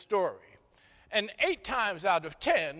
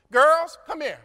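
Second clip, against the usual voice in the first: put-on Naomi calling the girls loudly; high loud call to the girls; 8 semitones higher, 8 dB louder than usual. Naomi calls her daughters-in-law to her, loud call.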